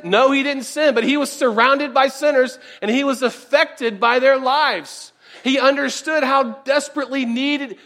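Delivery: loud, driving sermon voice; fervent preacher rising in force; preaching at full force. Acted. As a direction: press the point home to the congregation.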